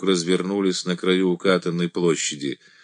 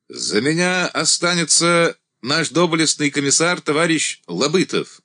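announcing; 7 semitones higher than usual. A narrator's reading. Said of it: "объявил Андрей".

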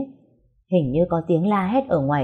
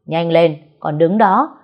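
loud, hurrying command; impatient shout; 6 dB louder, slower than usual. Acted. Young master shouts at dithering servant impatiently. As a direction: bark at him to move.

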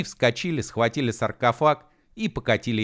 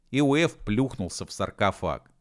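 ordinary delivery

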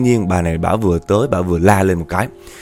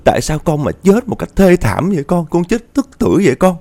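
high-pitched grieving wail, retold; acted mother's anguished lament; 9 semitones higher than usual. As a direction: cry out for a lost child, voice pitched way up. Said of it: "bà la quá trời la".

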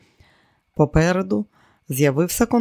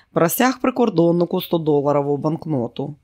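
plain reading voice

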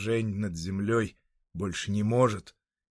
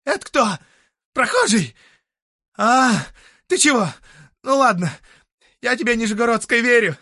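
put-on loud, high drunken hollering; drunken shouting; 13 semitones higher, 11 dB louder than usual. Drunk Sutyrin meeting a passer-by, high-pitched shouting. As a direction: bellow in bursts like a drunk. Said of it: "Он хорошо пьян".

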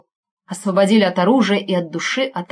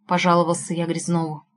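ordinary delivery

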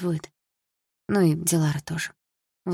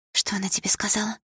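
worried, higher-pitched whisper; anxious whisper; 4 semitones higher, 4 dB quieter than usual. A narrator's reading. Friend asks what the teacher said, anxious whisper. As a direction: whisper it urgently, as a worried question. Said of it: "встревоженно прошептала Дилара".

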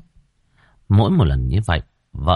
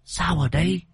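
high, thin, rasping spirit voice far above the narrator; a dead girl's ghost speaking through the medium, hoarse and high; eerie ghost voice; 11 semitones higher, 4 dB quieter than usual. Acted. Ghost of Hương speaking through the medium, eerie and high. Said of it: "phát ra âm thanh khe khé".

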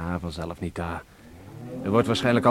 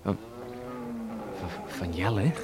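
stunned disbelief; 2 semitones lower, 11 dB quieter than usual. Acted. Son hears shocking news.

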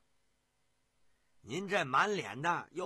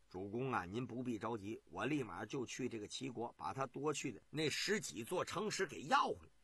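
压低声音，稳稳地安抚主公; 低而软，像宽慰焦急的君王; 沉稳安慰; 6 semitones lower, 6 dB quieter than usual. Acted. 苏秦安慰燕王. (苏秦低声安慰燕王)

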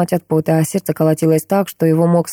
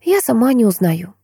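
higher, mildly weary daughter; a touch of tiredness; tired sigh; 5 semitones higher than usual. A narrator's reading. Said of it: "устало выдохнула девушка".

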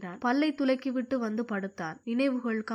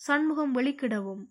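plain reading voice

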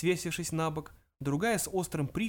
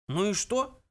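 ordinary delivery

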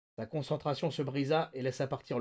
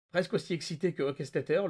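ordinary delivery

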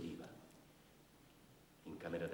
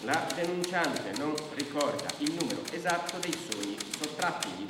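louder, stiff bureaucratic dictating; official dictation; 7 semitones higher, 8 dB louder than usual. Acted. Brigadier dictating the complaint for the record, officious. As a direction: read it into the record like a clerk.

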